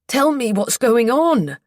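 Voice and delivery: loud, high, slow shout. A narrator's reading. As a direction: demand it at full voice. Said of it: "I didn't mean to shout".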